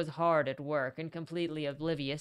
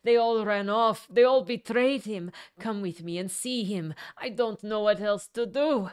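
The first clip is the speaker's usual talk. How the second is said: loud, high, frantic scream; 5 semitones higher, 8 dB louder than usual. A narrator's reading. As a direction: scream it out in desperate panic. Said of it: "she shook him and screamed".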